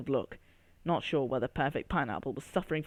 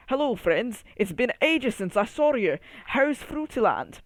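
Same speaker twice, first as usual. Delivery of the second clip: put-on a light Scottish accent, higher and louder; 6 semitones higher, 4 dB louder than usual. Banker Berry greets the bunch in a light Scottish accent. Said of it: "In his lovely, warm Scottish accent".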